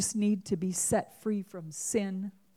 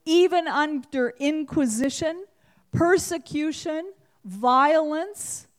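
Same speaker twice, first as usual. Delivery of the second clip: preaching; 7 semitones higher, 5 dB louder than usual. Acted. Builds preaching climax.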